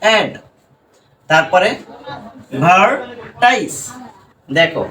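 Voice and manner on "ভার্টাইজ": ভার্টাইজ drawn out very slowly as a separate part; slow syllable demo. Shown as a demonstration. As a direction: say ভার্টাইজ slowly, apart from অ্যাড. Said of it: "এই যে দেখো এখানে separate করি".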